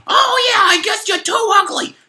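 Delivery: very high, loud brush-off; high beetle dumping the girl; dismissive beetle. Acted. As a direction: shrug her off, mocking.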